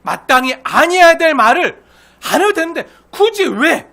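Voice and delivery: loud, high, drawn-out emphasis; exasperated rebuke. Real, not acted. Preacher rebuking needless talk, exasperated and loud. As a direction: punch it out in exasperation.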